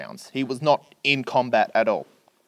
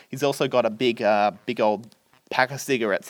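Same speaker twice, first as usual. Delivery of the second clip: ordinary delivery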